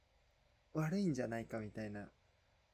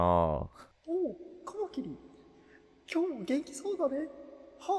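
put-on high-pitched old grandpa voice; imitating grandpa; 14 semitones higher, 6 dB louder than usual. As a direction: greet them like a cheery grandfather. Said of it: "俺のおじいちゃんのモノマネしてる？".

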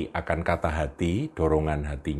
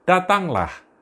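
calling out; 12 semitones higher, 5 dB louder, slower than usual. Acted. Jesus calls Peter over water.

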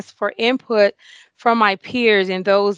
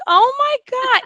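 high, stunned, overwhelmed; overwhelmed surprise; 3 dB louder than usual. Retold or imitated. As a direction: gasp it out, overcome. Seeing family and peers gathered.

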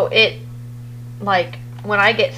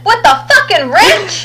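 high, slow disbelieving cry; mock outraged question; incredulous retort; 10 semitones higher, 4 dB louder, slower than usual. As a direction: ask it in shocked disbelief. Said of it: "And I said".

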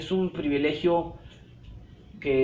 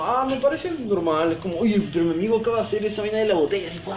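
fast, high, gushing wonder; mock amazement; 5 semitones higher, faster than usual. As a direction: gush over a trivial trick. Mocking amazed people over bottle trick.

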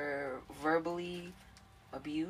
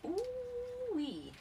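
high squealing laugh then ooh-wee. Real, not acted. Shrill laugh.

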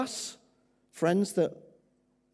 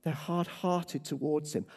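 ordinary talk, nothing marked

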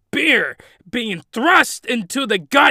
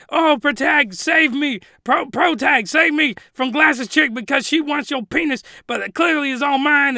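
high pleading damsel voice; mock damsel; 6 semitones higher, 3 dB louder than usual. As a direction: beg like a hammed-up damsel. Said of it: "us is like".

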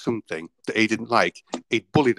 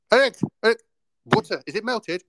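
raised, high-pitched call to a student; 11 semitones higher, 3 dB louder than usual. Retold or imitated. Cook calls student over the melting butter, urgent high call. Calling to busy student across kitchen.

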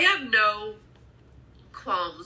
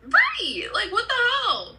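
exasperated; 11 semitones higher, slower than usual. Real, not acted. Exasperated agreement about rude commenters.

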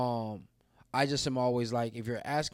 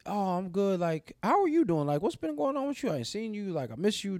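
eager friend; 8 semitones higher than usual. Pretend. Hoped-for friend replying warmly.

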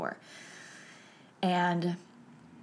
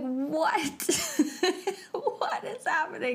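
high, breathy astonishment broken by laughter; retold stunned amazement with a laugh; laughing disbelief; 9 semitones higher, slower than usual. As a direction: gasp it out high, half laughing. Astonished at dad running back.

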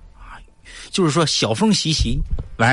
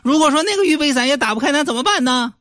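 mock worried reporter, much higher; high-voiced reporter. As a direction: ask fearfully in a high voice. Reporter pressing question. (高声装害怕的记者)

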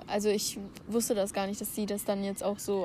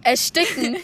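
shouted guesses, higher and louder; loud guessing; 8 semitones higher, 5 dB louder, slower than usual. Real, not acted. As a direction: call out each guess over the music. Guessing aloud wearing earbuds.

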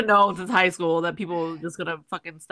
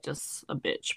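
usual speaking voice

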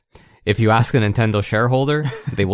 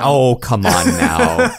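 slow, drawn-out louder mock protest; mock outrage; 6 dB louder, much slower than usual. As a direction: pretend to be offended, stretching every word. Mock protest at cohost's jab.

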